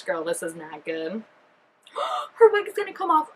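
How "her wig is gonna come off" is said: shocked gasp. Real, not acted. Gasps at the wig slipping.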